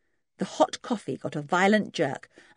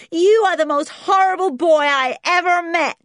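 put-on high, loud childish wail; bitter outburst; 13 semitones higher, 10 dB louder than usual. A narrator's reading. Beaten spoilt boy accusing George, loud wailing outrage. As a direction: cry out with helpless indignation.

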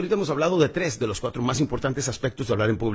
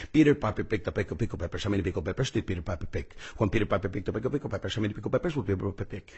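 softer, blurred tongue twister through a pen; pen in mouth; 5 semitones lower, 5 dB quieter than usual. Shown as a demonstration. Speaker relives his pre-talk warm-up, pen in mouth. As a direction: mumble it past a pen clamped in the molars. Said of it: "estaba yo con mi pluma en mi habitación".

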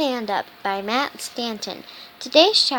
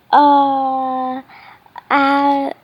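loud stuck stammer, voice changed; 5 dB louder than usual. Acted. Nervous boy stuck in front of Pip, loud stammering.

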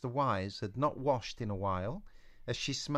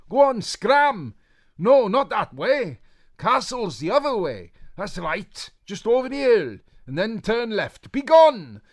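high, loud bossy shooing; shouting witch; 13 semitones higher, 15 dB louder than usual. Acted. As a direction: shoo her away over the hill. Witch shoos Eliza off.

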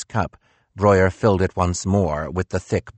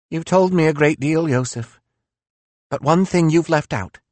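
higher, bitter philosopher voice; reproachful, raised-pitch Nietzsche; 10 semitones higher than usual. Acted.

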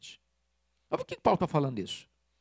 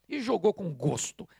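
normal talking voice

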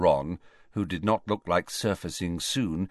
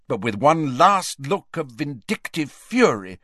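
ordinary delivery, nothing marked

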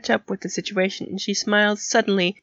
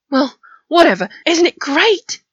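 loud, high elation; gleeful, raised-voice enthusiasm; beaming; 8 semitones higher, 6 dB louder than usual. A narrator's reading. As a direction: burst out delighted, pitched up. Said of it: "he beamed".

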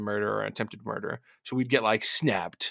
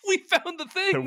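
excited. Pretend. Triumphant joke line.

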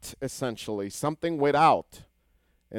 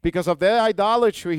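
higher and louder preaching emphasis; "idolatry" hammered home loud; forceful repeat; 6 semitones higher, 7 dB louder than usual. Shown as a demonstration. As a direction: proclaim "Because of their idolatry" with force. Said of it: "repeated louder".

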